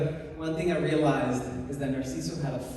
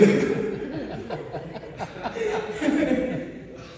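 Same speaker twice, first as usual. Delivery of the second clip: long airy laugh trailing down; breathy laughter; 10 semitones higher, 4 dB louder than usual. Real, not acted. Friends sharing a memory, airy laughter. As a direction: let the laugh run out of breath.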